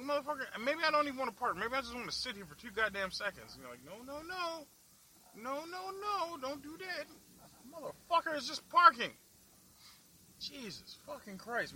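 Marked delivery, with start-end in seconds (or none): 3.8-7.1 high, mocking nagging voice; mock scold. Driver mocking parking rules in a high nagging voice. 7.7-11.7 louder, higher fed-up rant; exasperated outburst. Real, not acted. Driver ranting at city parking, loud and fed up.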